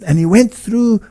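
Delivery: usual speaking voice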